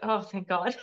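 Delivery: laughing with relief; relieved laugh. Retold or imitated. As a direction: let the relief spill out as a laugh. Relief after cancer prognosis.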